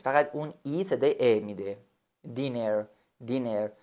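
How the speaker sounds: usual speaking voice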